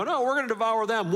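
defiant mock spy voice; bold spies. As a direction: answer back with faith. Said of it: "They go".

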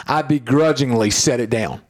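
ordinary talk

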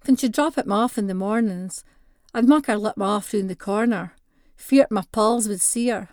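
usual speaking voice

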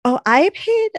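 loud, high outburst of exasperation; exclaiming. Real, not acted. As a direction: jump in high and loud with disbelief. Mock outrage about late fees.

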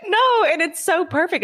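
high-pitched, drawn-out words through real laughter; laughing reassurance. Real, not acted. Host reassuring guest who said sorry, laughing. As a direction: still cracking up, voice jumping way up.

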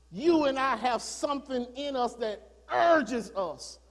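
rising to loud gospel preaching; fiery preaching. Acted. Preaching builds to full force.

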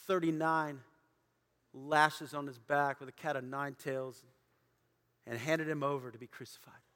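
low, soft, grave delivery; solemn quiet preaching. Acted. Pastor confronting listeners with their decision, quiet and grave. Sombre close of the message.

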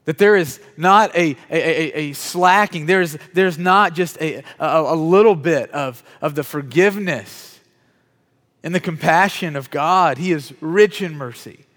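loud, weighty proclamation with drawn-out words; forceful preaching. Real, not acted. Preacher telling the congregation God is rich in mercy, forceful preaching. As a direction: proclaim it slowly and forcefully to the room.

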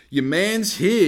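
loud, high swaggering call; cocky announcement. Retold or imitated. Walking into the shop proud, loud cocky announcement.

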